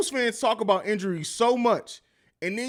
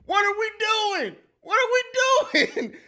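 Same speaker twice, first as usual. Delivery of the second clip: high, strained cry of disbelief; exasperated shout. Real, not acted. Streamer yelling at chat over typos, high exasperated cry. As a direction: yelp at the absurdity.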